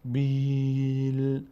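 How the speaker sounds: بیل long and level, a not full; light alif. Shown as a demonstration. Teacher demonstrating the light alif. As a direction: draw out بیل without filling the a. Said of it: "صدای آ اما پر نمیشه".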